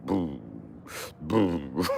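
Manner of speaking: normal talking voice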